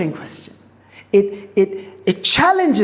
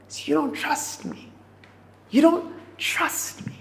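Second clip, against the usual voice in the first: soft pleading Jesus; 5 semitones higher, 7 dB quieter than usual. Acted. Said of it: "He's saying".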